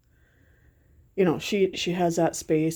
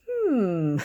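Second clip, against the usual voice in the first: unimpressed hmm; 2 semitones higher than usual. Retold or imitated. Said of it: "it's kinda like".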